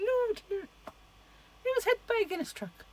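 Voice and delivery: put-on very high would-be Irish accent; high-pitched mock Irish. Movie character answering, very high not-quite-Irish accent. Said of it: "why am I getting so high-pitched".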